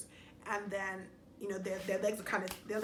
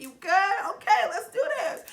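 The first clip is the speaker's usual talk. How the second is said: loud, high mock party enthusiasm; imitated hype-up; 10 semitones higher, 7 dB louder than usual. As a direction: playfully imitate a friend hyping someone up. Voicing birthday boy cheering her up.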